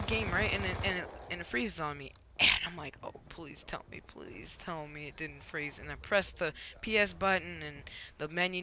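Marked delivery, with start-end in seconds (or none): 3.0-5.8 hushed pleading. Retold or imitated. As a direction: beg the console under your breath. Retold pleading with frozen console.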